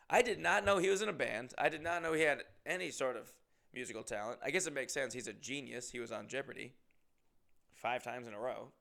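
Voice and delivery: ordinary talk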